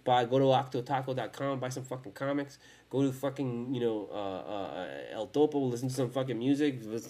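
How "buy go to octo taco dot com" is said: ordinary talk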